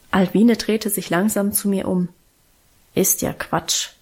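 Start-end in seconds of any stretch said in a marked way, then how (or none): 2.9-3.9 whispered grumble, low and dismissive; a low, breathy dismissive murmur; grumbled. A narrator's reading. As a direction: brush it off under your breath. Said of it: "brummte sie".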